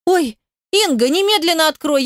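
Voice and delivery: high, flustered demand; high-pitched plea through a door; urgent calling. A narrator's reading. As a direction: call out suddenly, pitched well up.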